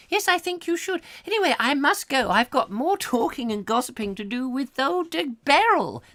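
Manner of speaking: put-on very high shocked old lady; gossiping old lady. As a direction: squeal it, high and prim. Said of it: "I've got to get out of character".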